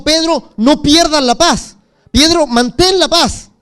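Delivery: loud, slow earnest plea to Pedro; urgent plea. Retold or imitated. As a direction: press each word, pleading. Jesús mira a Pedro, súplica. (súplica fuerte y lenta a Pedro)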